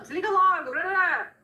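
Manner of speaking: high, loud snapping nag; nagging parent. Retold or imitated. Parent impatiently ordering the teen off the screen. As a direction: bark it like a fed-up parent.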